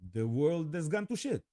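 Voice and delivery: ordinary talk, nothing marked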